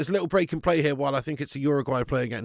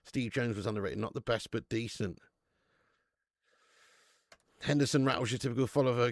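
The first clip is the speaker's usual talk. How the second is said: normal talking voice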